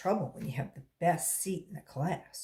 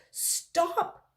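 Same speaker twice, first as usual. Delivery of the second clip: cry out; 10 semitones higher than usual. A narrator's reading. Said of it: "cried Jason".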